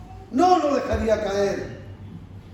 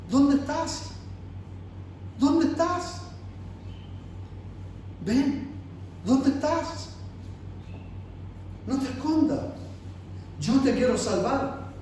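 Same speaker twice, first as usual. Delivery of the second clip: a touch of softer, tearful calling; tender pleading; 7 dB quieter than usual. Retold or imitated. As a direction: gently plead for the lost one to come home. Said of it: "Él está diciendo a usted ahora".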